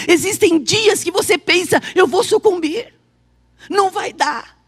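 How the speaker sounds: emphatic, ringing proclamation of promise and distress; forceful preaching. Acted. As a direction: preach it out to the congregation.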